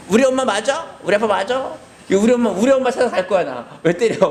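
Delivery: put-on high, fast childish protest; bratty child complaining; whining child. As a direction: whine like a hit kid. Child whining after spanking.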